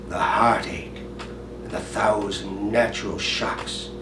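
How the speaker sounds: low, soft Hamlet soliloquy with long pauses; brooding soliloquy. Acted. Hamlet pondering whether to be or not, hushed soliloquy.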